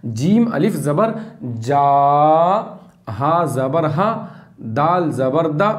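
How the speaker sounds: slow, syllable-by-syllable spelling with pauses; slow spelling drill. Shown as a demonstration. Teacher spelling letters slowly for students to copy. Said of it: "हिज्जे करके पढ़ना है".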